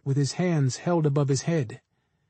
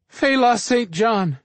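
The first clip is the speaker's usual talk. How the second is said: slow, high, pleading scream of the name; terrified scream; 5 semitones higher, slower than usual. Acted. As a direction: cry out the name in terror. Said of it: "the couple screamed".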